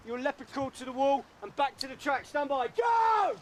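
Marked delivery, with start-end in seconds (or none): 2.3-3.4 high, loud drill order; shouted command. Real, not acted. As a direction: bark the start order. Drill command to start crawling.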